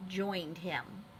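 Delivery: plain reading voice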